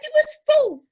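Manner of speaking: outraged, voice leaps up high; indignant exclamation. Acted. Daughter complains to mum about lift.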